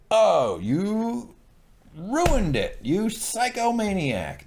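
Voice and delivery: high, slow dismayed scolding; high-pitched exasperated reproach. Real, not acted. Scolding Ramsay dramatically.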